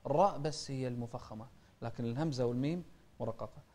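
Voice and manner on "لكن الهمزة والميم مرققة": normal talking voice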